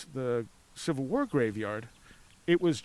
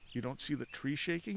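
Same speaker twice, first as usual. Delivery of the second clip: retold hushed question, much softer; quiet; 8 dB quieter than usual. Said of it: "both got quiet".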